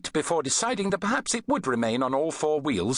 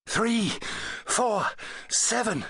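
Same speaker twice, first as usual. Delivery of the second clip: panting; 8 semitones higher, slower than usual. A narrator's reading. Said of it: "she panted".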